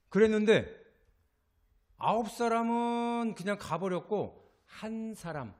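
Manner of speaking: ordinary talk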